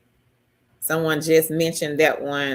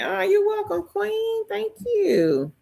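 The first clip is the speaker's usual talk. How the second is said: much higher, sing-song thank you to a viewer; sweet high thanks; 13 semitones higher than usual. Real, not acted. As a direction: lift the voice way up, gushing.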